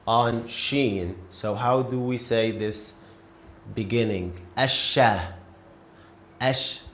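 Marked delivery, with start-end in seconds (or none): 4.6-5.3 Asha with no l, the sh doubled; silent lam. Shown as a demonstration. Teacher shows the sun letter to a student. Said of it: "Did you hear it? I didn't pronounce lam".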